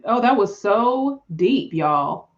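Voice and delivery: ordinary delivery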